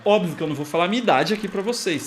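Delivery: normal talking voice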